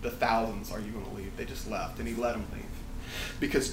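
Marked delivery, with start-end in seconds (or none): 0.7-1.3 quiet rhetorical quote. Retold or imitated. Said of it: "Notice Jesus didn't ask".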